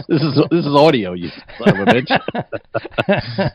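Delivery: slow, drawn-out mock insult; mock outrage. Host teasing a silent co-host with slow mock anger. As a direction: stretch it out in fake fury.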